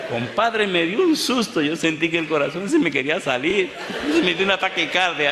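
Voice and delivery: high, loud panicked storytelling; comic fright. Retold or imitated. Preacher tells the congregation of his scare, comically panicked.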